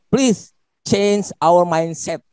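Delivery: drawn out word by word, pressing the point; slow emphatic appeal. Real, not acted. To fellow staff, urging a change of mindset, slow and emphatic. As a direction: lean on each word, slowly.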